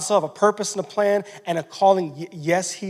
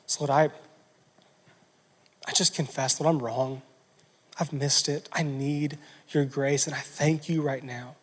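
quiet confession prayer; 4 semitones lower, 7 dB quieter than usual. Acted. Preacher voicing a humble confession to God, softly. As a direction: confess it gently, head bowed.

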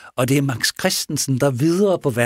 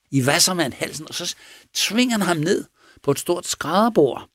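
ordinary delivery

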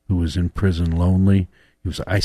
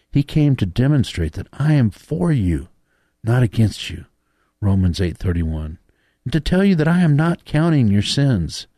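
rising higher and louder; building fervent preaching; 6 semitones higher, 5 dB louder than usual. Acted. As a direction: build the proclamation to a peak. Preacher reads God's letter, building.

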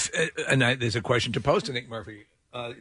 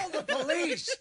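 laughing mock plea; 6 dB quieter than usual. Mock emergency call skit.

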